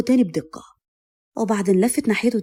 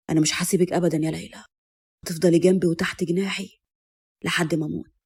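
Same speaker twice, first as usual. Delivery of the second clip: tender, reassuring man's low voice; devoted husband; 4 semitones lower than usual. A narrator's reading. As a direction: promise lifelong love, pitched down.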